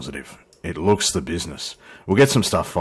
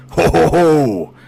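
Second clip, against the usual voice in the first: mock jolly laugh; 9 semitones higher, 6 dB louder, slower than usual. F-18 pulls up beside him.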